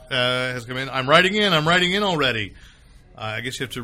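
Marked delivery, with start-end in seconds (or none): 0.9-2.5 kvetching. Shown as a demonstration. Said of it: "read that with a, you know, kvetching voice".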